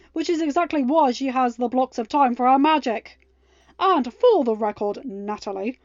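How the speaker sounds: loud, forceful telling-off; angry defence. A narrator's reading. Ben defending Mal to her critic on the phone, loud and angry. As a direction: lay down the law, barely holding back.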